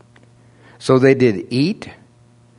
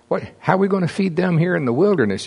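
mock panic; 7 semitones higher, faster than usual. Disciples' panicked question retold.

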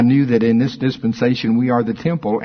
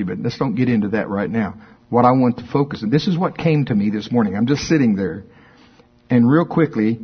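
ordinary talk, nothing marked

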